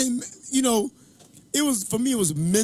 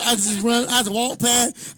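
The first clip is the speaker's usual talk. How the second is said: usual speaking voice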